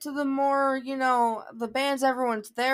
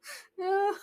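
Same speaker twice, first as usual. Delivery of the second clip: laugh; 8 semitones higher than usual. Real, not acted. Laughing at being dazed.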